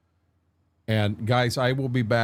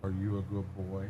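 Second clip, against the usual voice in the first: soft cooing pet voice, much quieter; talking to a dog; 2 semitones lower, 7 dB quieter than usual. Real, not acted. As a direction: ask the dog sweetly and softly. Soft talk to his dog.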